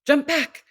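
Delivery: high, fast, staccato warning; mother warning her baby of danger, staccato; sharp warning. Shown as a demonstration. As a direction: snap it out to stop a child in danger. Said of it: "It's fast and staccato".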